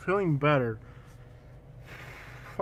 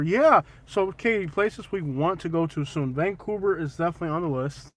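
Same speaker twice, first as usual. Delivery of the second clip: ordinary delivery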